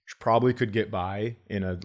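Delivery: ordinary talk, nothing marked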